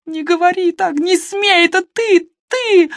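very high, loud shrieking cry; a sister shrieking at her brother; hysterical screaming. A narrator's reading. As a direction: shriek him down in panic.

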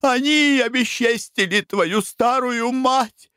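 high, wailing protestation; hysterical weeping. A narrator's reading. Mother sobs her innocence to Frank and Foker, high. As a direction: swear innocence through tears. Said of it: "истерическим плачем".

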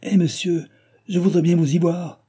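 high-pitched, flustered protest; rattled, indignant comeback; frightened retort. A narrator's reading. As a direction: snap back in a panic. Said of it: "répondit le postillon effaré".

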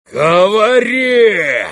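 long, high, bellowed command; drawn-out furious bellow; frenzied roar. Acted. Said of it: "ревел генерал в совершенном исступлении".

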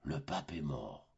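stunned echo. Acted. Pious mother hearing the pope is dead, stunned hush. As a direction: repeat it stunned and hushed, as if struck. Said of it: "fit la pauvre mère".

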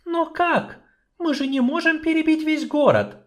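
high, helpless voice; despairing sorceress. Acted. Sorceress answering the dwarf, high and helpless. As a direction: plead that it's impossible.

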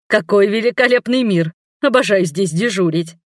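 usual speaking voice

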